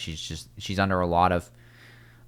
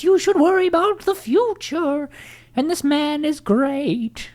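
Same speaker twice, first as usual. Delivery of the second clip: mock tarot reader; 14 semitones higher, slower than usual. Tarot card lady doing a reading for Rachel, in a hammed-up high voice. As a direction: put on a swooping, high-pitched fortune-teller voice. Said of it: "this lady was re-, doing her reading".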